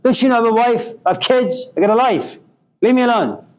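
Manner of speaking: mock angry son, pitch climbing toward the end. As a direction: snap at mom, fed up and rising. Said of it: "really angry".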